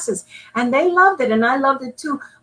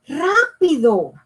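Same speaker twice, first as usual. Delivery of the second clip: hurried yell; 6 semitones higher, slower than usual. Acted. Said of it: "Papa yelled".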